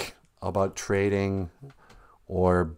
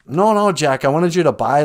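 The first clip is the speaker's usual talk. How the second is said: higher, louder exasperated correction; scolding; 5 semitones higher, 5 dB louder, faster than usual. Acted. Mother corrects Jack over the beans, exasperated. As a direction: set a foolish son straight.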